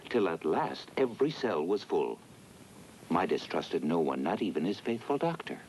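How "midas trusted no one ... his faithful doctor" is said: ordinary delivery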